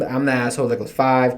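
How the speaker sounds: ordinary talk, nothing marked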